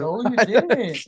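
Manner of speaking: drawn-out loud denial. Real, not acted. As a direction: bellow the denial slowly. Joking argument, loud slow denial.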